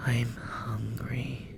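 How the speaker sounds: slow, breathy, rasping whisper; hungry creature whispering; raspy breathy whisper. Acted. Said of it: "she spoke in a rasping, breathy voice".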